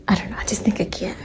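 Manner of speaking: quick hushed whisper. Real, not acted. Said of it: "I'm whispering 'cause he is sleeping".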